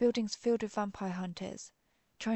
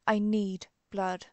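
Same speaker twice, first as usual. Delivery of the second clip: slow, louder, urgent demand; desperate craving; 6 dB louder, slower than usual. Acted. Hungry vampire begging the doctor for a drink, desperate. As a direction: stretch it out forcefully, aching with need.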